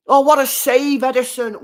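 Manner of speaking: high, loud cry of relief; excited shout. Real, not acted. Streamer cheering the keeper's save, excited shout. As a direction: yell it out at the save.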